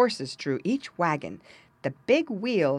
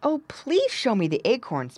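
slightly higher pleading piglet; a touch of pleading; begging; 6 semitones higher than usual. A narrator's reading. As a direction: beg mildly, as a little pig. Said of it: "begged Squinty".

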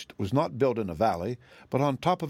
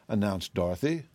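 ordinary delivery

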